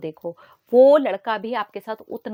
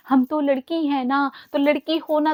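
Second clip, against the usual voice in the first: mock girl's excuse; 6 semitones higher, 3 dB louder than usual. Speaker mimics girls excusing themselves, mock plea. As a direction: lightly mimic a self-excusing girl, stretching the words. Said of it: "यहाँ पर भी एक भ्रांति फैला रखी है".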